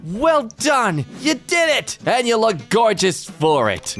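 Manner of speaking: high, excited congratulations; gushing praise. Acted. Host to someone who dared a photo, gushing praise. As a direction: cheer them on with exaggerated delight.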